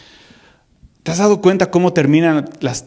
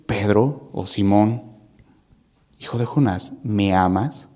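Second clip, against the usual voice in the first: tender question; 8 semitones lower, 4 dB quieter than usual. Retold or imitated. Jesus gently questions Peter.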